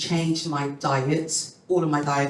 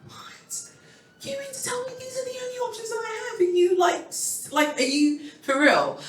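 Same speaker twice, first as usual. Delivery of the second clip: much higher, fast, breathy disbelief. Retold or imitated. Hearing the only options from the doctor, high stunned disbelief. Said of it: "I was like".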